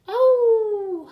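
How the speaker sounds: very loud, high howl of dismay; a sudden loud wail as they fall wet. A narrator's reading. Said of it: "howled Little Wolf and Smells".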